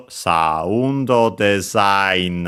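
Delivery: slowed pitch accent demo; slow Japanese demo. Shown as a demonstration. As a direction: sound design as saundo dezain, drawn out very slowly. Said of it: "it's low after that".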